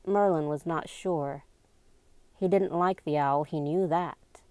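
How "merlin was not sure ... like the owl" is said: plain reading voice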